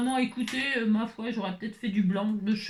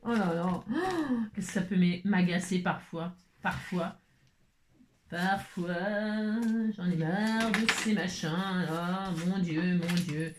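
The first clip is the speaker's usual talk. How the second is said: weary slow sigh; 5 semitones lower, slower than usual. Real, not acted.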